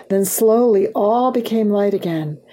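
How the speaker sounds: ordinary delivery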